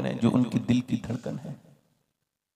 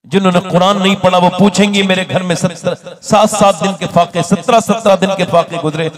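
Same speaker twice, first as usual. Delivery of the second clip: louder, higher fiery sermon; preacher voicing the Prophet's daughter on Judgment Day; preaching at full force; 6 semitones higher, 10 dB louder than usual. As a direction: hammer home the warning.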